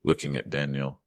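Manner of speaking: usual speaking voice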